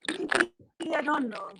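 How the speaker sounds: put-on light African accent, drawn out. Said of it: "I put on my African accent".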